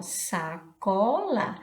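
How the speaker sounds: sacola slowly stretched into its syllables. Shown as a demonstration. Teacher finding words inside a word, sacola slowly syllabified. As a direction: break up sacola slowly for learners. Breaks down word to find others.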